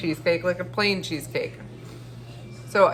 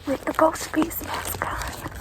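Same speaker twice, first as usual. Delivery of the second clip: breathy sneaking whisper; 8 semitones higher, 4 dB quieter than usual. Real, not acted. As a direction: whisper so nobody hears you. Sneaking up to neighbors' house.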